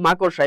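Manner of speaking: মাকড়সা said as "ma-kor-sha", o before the r; correct pronunciation. Shown as a demonstration. Teacher summing up for students, মাকড়সা pronounced correctly as "ma-kor-sha". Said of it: "এটার উচ্চারণ হচ্ছে কি".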